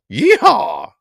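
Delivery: high, loud yeehaw whoop; cowboy whoop. Acted. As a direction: holler it like a rodeo cowboy. Playing cowpoke for chat.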